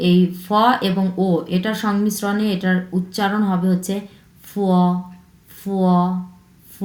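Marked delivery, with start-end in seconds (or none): 4.4-6.8 ফ said with air pushed out of the mouth; Chinese f sound. Shown as a demonstration. Teacher drilling the Chinese sound to learners, airy ফ. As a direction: breathe air out on ফ. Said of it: "like this".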